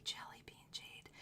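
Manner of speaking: hushed, breathy whisper of awe; awed whisper to the camera. Real, not acted. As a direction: whisper it like a secret treasure. Showing jade to live viewers.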